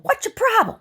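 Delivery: high, loud put-on scolding voice; telling off a bull in a high, loud cartoon voice; mock scolding. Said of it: "I said".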